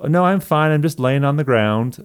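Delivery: high-pitched, breezy mock reply; retold reassurance. Retelling reply from bathroom floor.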